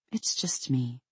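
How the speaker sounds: very soft, reassuring hush; low soothing murmur. A narrator's reading. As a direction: murmur it softly to calm the horse. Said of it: "her voice low and soothing".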